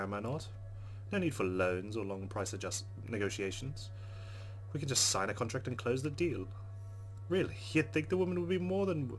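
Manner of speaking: normal talking voice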